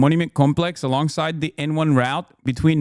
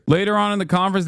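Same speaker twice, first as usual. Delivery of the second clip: ordinary talk, nothing marked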